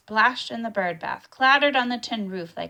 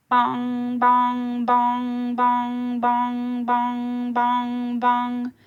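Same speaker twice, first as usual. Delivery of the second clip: loud, higher booming bell tolls; church bell bongs; 4 semitones higher, 6 dB louder, much slower than usual. A narrator's reading. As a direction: toll each bong like a distant church bell. Church bells ringing imitated.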